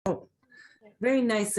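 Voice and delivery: ordinary delivery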